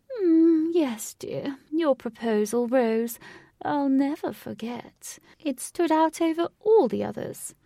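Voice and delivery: put-on fond old lady remembering her proposal, slow; sentimental old lady. A narrator's reading. Old wife to husband remembering proposal, sentimental.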